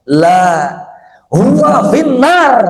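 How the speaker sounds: "huwa finnar" drawn out high and slow; "La", then "huwa finnar" soaring in pitch; emphatic denial. Acted. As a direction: pronounce a solemn verdict, stretching "huwa finnar". Prophet's emphatic denial, acted.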